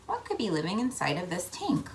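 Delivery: plain reading voice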